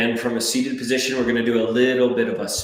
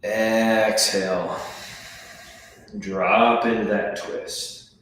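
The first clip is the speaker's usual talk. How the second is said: slow stretched cue; slower than usual. Shown as a demonstration. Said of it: "cue Exhale".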